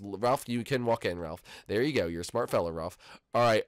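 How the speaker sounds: ordinary talk, nothing marked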